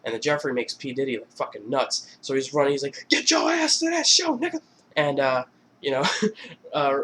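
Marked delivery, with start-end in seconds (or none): 3.1-4.6 very high, frantic mock yell. Crazed boss chasing them down the hall, high mock yell. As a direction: shout an order in a wild, pitched-up voice. [name] gone nuts, chasing.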